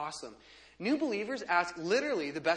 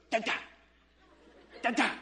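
imitating a baby's insistent babble; fervent scowling baby; 3 semitones higher, faster than usual. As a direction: babble in short, scolding bursts with a scowl. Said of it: "If when she's sixteen years old".

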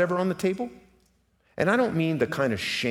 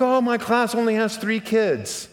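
1 mock complaint; 12 semitones higher, 7 dB louder than usual. Mimicking a complaining teacher.

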